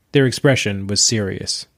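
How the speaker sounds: plain reading voice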